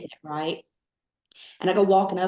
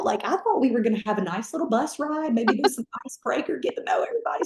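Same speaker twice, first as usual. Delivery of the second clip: high, incredulous whine; mock disbelief, voice climbing; shocked dismay; 7 semitones higher than usual. Recruit realizes training starts now, shocked and high.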